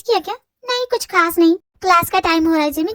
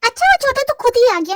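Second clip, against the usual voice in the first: normal talking voice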